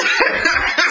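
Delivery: breathy burst of laughter; unvoiced laugh. Real, not acted. Co-host laughing at the Cable joke, short unvoiced laugh.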